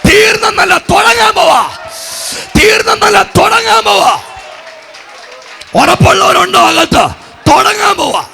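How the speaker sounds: high, loud revival shout; full-force preaching. Real, not acted. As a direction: cry out each phrase to rouse the congregation. Preaches new beginnings at full force.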